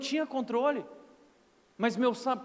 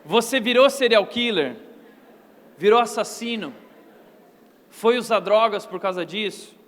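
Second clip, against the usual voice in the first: mocking challenge; 7 dB louder than usual. Real, not acted. Preacher challenging the congregation.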